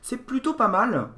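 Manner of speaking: high, loud impressed "oh"; real surprise at what lights up; pleasantly surprised. Real, not acted. Reviewer seeing the mouse light up, impressed.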